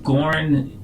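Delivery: normal talking voice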